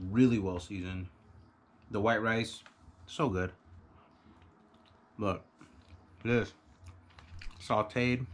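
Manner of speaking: speaking between bites; talking while chewing. Real, not acted. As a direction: mouth partly full of food. Seen 0: Bite taken at -1.0; mouth working, face down over food.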